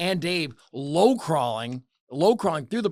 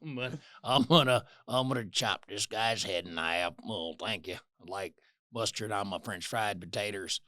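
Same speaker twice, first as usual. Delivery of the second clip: Carl impression; 8 semitones lower, 5 dB quieter than usual. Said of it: "this is Carl".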